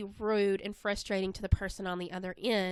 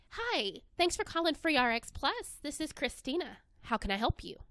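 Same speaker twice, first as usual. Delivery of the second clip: bright, higher, cheerful greeting; friendly phone greeting; 5 semitones higher than usual. Shown as a demonstration. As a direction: smile through the phone with your voice. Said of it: "That tends to be a little bit more friendly than".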